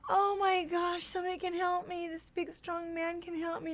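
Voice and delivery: high-pitched wide-eyed hope; imitated naive relief. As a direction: play a grateful young girl who thinks she is rescued. Naive girl thrilled at rescue.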